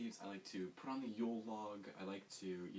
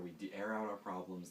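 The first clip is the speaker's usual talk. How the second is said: normal talking voice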